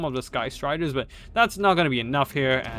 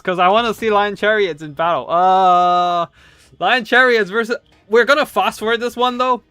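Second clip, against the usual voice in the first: excited; 6 semitones higher, 7 dB louder than usual. Real, not acted. Caster keeps gushing about chariots.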